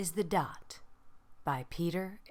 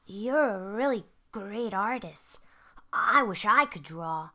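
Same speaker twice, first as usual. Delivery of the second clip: put-on little boy voice; 4 semitones higher, 6 dB louder than usual. Little boy admires her.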